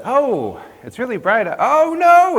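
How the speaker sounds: a vampire caught by the dawn, voice cracking upward; panicked cry. Acted. Vampire in the sunrise, shrieking in panic.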